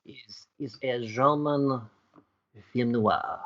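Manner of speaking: slow, put-on French accent. Host pitching a German film noir, slipping into French accent. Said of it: "I'm going French".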